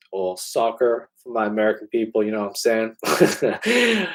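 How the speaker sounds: "soccer" in a put-on American accent; a quick playful American accent for the viewers; mock American accent. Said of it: "you're gonna hate that accent".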